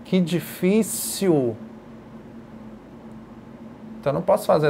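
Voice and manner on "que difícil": very slow, strained exclamation; exasperated difficulty. Shown as a demonstration. Teacher voicing the sign for difficult, slow and strained. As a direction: stretch it out as if it were a real struggle. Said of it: "Então, eu tenho esse sinal".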